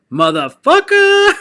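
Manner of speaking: high, loud, slow sneer; drawn-out insult. Real, not acted. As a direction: stretch the insult out, laughing at him. Insulting rival player, fired up.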